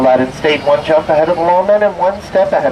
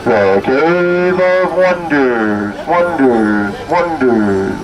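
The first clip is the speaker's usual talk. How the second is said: echo effect; much slower than usual. Pretend. Echoing Cave of Wonders name.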